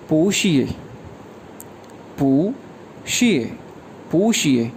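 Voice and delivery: पूशिए drawn slow, falling at the end; model pronunciation. Shown as a demonstration. Teacher modelling the reply पूशिए, slow fall. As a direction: repeat पूशिए carefully. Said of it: "उसको कहा जाता है".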